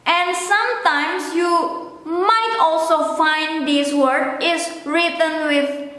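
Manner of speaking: ordinary talk, nothing marked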